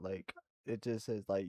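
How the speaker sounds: normal talking voice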